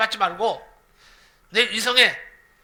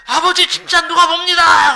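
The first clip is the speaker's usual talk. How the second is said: high, loud yell in a put-on child voice; boy yelling again; 8 semitones higher, 11 dB louder than usual. Son yelling to his father that someone truly sees, high loud yell. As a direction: yell the warning even more urgently. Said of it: "그러는 거예요".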